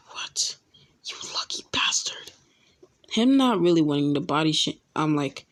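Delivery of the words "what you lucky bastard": unvoiced whisper into his ear. Whispering in his friend's ear about her, hushed whisper. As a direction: whisper it like a secret meant for one ear. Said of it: "whisper in his ear".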